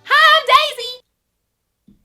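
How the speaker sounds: high, loud, slow squeaky princess greeting; Daisy impression. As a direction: belt out a shrill cartoon princess hello. Imitating Daisy's intro.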